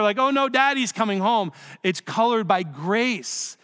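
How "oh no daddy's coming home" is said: higher, hammed-up childlike dread; mock fear. Child hears that daddy's coming home, mock fear. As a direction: imitate a kid dreading daddy's arrival. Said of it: "isn't colored by fear".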